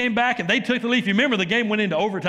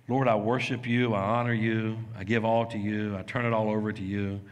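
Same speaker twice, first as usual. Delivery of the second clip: soft surrendered prayer; 7 semitones lower, 4 dB quieter than usual. Shown as a demonstration.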